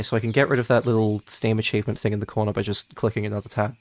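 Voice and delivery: ordinary talk, nothing marked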